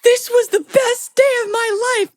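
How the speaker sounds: very high, loud ecstatic squeal; starstruck gush. Retold or imitated. Fan calling after [name], ecstatic squeal. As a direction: gush it at the top of your voice like a giddy fan. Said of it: "I'm like".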